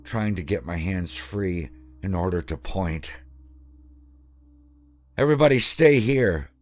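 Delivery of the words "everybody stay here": high, loud commanding shout; urgent yell. Acted. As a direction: bark orders at the scared kids. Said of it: "the troop leader yelled".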